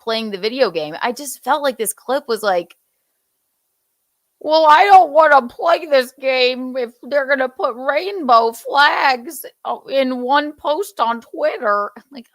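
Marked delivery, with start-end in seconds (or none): 4.4-11.9 higher, put-on indignant voice; mock whiny complainer. Host imitating the upset gamer's clip, mock indignation. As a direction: complain in a high mocking voice.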